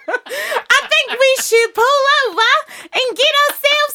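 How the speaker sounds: very high, shouted bad Australian accent; awful Australian accent. Shown as a demonstration. Waking the sleepy driver in the van, awful Australian accent. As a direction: belt out a hammed-up Australian voice, high and loud. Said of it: "me doing Australian accent 'cause I'm awful".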